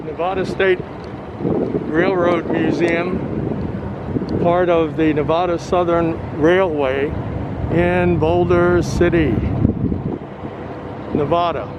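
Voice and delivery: talking through a face mask; muffled through mask. Real, not acted. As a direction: speak muffled behind a mask. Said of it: "so if it's sort of muffled".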